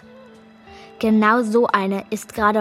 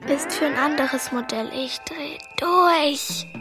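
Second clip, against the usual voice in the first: whispering; 3 semitones higher, 3 dB quieter than usual. Acted. Hiding, phone dead.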